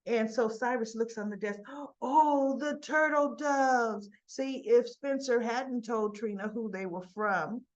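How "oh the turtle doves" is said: gasp, then a slow, loud oh; hammed-up delight at spotting the doves; mock surprise. Cyrus finding the turtle doves, mock surprised gasp. Cyrus spots the gift on desk.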